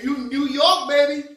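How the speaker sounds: loud, high New York shout-out; big cheeky hometown cheer; playful hype. Pretend.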